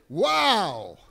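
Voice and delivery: loud astonished wow. Shown as a demonstration. Preacher to congregation, loud amazed wow. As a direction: shout it in amazement. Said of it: "look at the person next to you and say".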